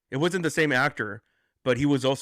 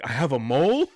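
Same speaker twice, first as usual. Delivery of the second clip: mock surprised; 4 dB louder than usual. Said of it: "And he's like".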